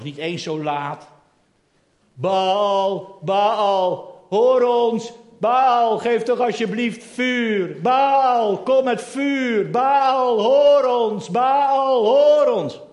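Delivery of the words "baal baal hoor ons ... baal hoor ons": loud chanting cry to Baal; priests shouting. Acted. Priests of Baal crying out to their god, loud chant. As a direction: call out to Baal over and over. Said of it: "lopen ze dat te schreeuwen".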